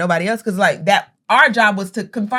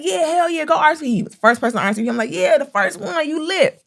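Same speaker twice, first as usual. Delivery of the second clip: higher, hyping up a friend; hyped cheer; 8 semitones higher than usual. Retold or imitated. Hyping friend Vance for RSVPing first, higher excited. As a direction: cheer him on like he won. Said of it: "I was like".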